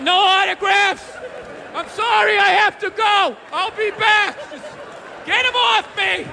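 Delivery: mock rock star. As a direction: wave off fans like a star. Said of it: "And you're like".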